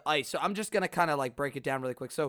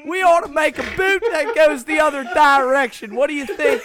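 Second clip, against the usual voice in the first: higher, louder mock-inventor voice; excited townsperson; 6 semitones higher, 6 dB louder than usual. Host mocks the boot story to co-hosts, hammed-up townsperson. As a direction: pitch the bright idea like an old-time local.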